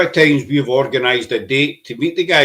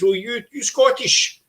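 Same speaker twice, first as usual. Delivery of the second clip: high, slow mock tourist asking; imitating the tourist; 10 semitones higher, slower than usual. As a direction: lightly voice the eager foreign tourist. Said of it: "and he says".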